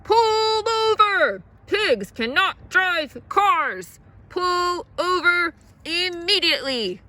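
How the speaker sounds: very loud, high, drawn-out call; officer ordering the car to stop; bullhorn shout. A narrator's reading. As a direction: bark it through a megaphone. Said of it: "shouted into his bullhorn".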